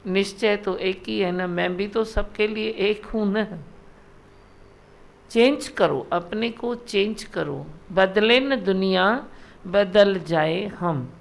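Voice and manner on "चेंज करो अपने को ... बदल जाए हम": slow maxim. Acted.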